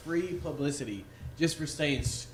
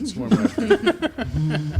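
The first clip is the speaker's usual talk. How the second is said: laughing; 8 semitones higher, 6 dB louder, much slower than usual. Acted. Teasing twist, laughing.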